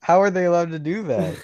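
high and loud, shocked: how are they allowed; disbelief. Real, not acted. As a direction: ask it in loud, high outrage. Shocked at real brand.

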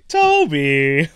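loud, drawn-out sitcom holler; sitcom catchphrase. Pretend. Mimics every call to muted friend, loud sitcom shout. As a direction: belt out the name like a running gag. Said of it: "It's like a sitcom now".

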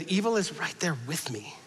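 breathy ominous whisper. Acted. Preacher reading Paul on sin, whispered. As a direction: whisper it like a secret threat.

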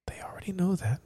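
hushed stage whisper; mock whisper. Host quietly scolding the producer about listeners, mock whisper. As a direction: whisper it like a secret aside.

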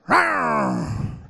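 high teasing rawr; playful growl.

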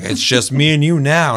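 villain speech. Retold or imitated. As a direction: declaim it to a trapped last victim. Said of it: "had this whole villain speech".

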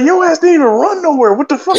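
high mocking teammate voice; teasing squeal at the injured guy; mocking friend. Teammates mocking him for a turf toe, high and teasing. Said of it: "it's like".

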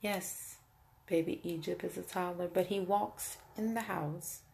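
hushed disbelief. Real, not acted. Mother confirming it was her toddler who walked in, hushed. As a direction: say it under your breath in disbelief.

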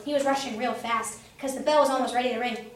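usual speaking voice

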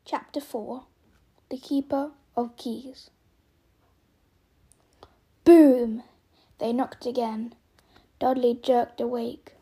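storyteller reading aloud; story narration. A narrator's reading. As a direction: read it like a bedtime story.

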